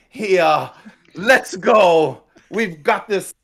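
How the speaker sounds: high, loud, slow hype call; rally the crowd; rousing crowd shout. Acted. Character hypes the crowd.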